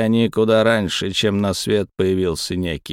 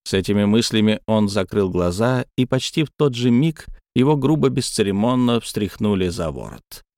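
ordinary delivery

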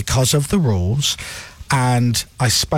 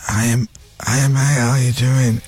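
imitating sick friend; 3 semitones lower than usual. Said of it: "instead of talking like this".

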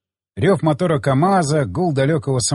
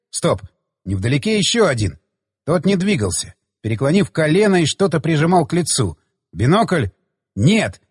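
plain reading voice

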